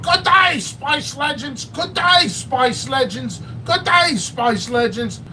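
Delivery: high, drawn-out Aussie greeting; mock Australian shout of good day; Australian accent. Mocking the Australian reviewer's greeting, in an Aussie accent. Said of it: "that Australian".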